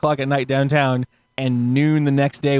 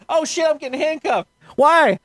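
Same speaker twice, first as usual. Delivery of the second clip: playing his own alarm for laughs; mock panicked surprise; 11 semitones higher than usual. Narrator to friends, getting cuffed: high mock panic. Retelling being arrested.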